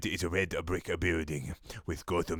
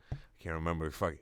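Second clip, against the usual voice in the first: ordinary delivery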